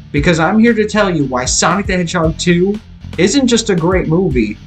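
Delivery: ordinary talk, nothing marked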